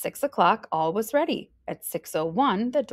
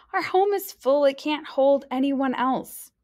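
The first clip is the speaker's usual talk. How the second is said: cried out; 7 semitones higher than usual. A narrator's reading. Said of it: "cried Ollie".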